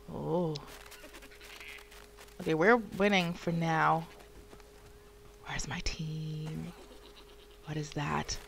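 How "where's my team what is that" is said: whispered. Real, not acted. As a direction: breathe the question out secretly. Looking for team in game.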